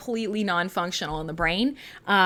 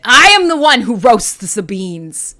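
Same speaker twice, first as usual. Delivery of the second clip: a much louder, menacing mock declaration of "roasts"; Breaking Bad parody; 10 dB louder than usual. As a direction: boom it out like a TV drug kingpin. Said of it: "it is a little Breaking Bad".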